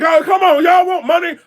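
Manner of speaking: high, loud mock outcry; mock yell. Vlogger mocking leagues who won't promote, high loud outcry. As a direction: shout it at the leagues like they're missing out.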